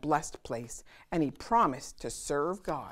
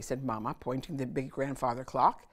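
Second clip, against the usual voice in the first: usual speaking voice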